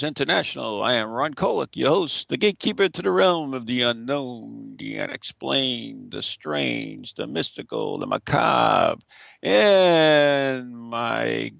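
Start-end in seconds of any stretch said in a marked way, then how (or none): none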